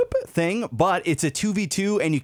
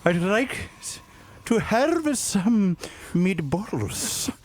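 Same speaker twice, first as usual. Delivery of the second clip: hammed-up Indian accent, slow and halting; put-on Indian accent, dragging out each phrase; mock Indian accent; 2 semitones lower, 3 dB quieter, much slower than usual. Ordering at an Indian restaurant on request, slow mock Indian accent.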